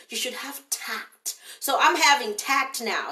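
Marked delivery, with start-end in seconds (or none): 0.1-1.4 whispered scold. Retold or imitated. Quoted critic, fading to whisper.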